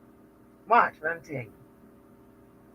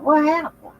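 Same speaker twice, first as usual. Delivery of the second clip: coworkers asking; 11 semitones higher, 4 dB louder than usual. Retold or imitated.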